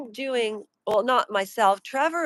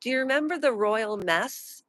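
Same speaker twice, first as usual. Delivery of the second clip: ordinary talk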